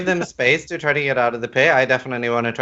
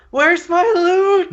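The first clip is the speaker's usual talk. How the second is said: mock scream; 4 dB louder, slower than usual. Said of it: "I wanna scream out".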